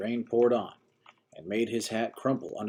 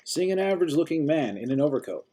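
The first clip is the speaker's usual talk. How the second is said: plain reading voice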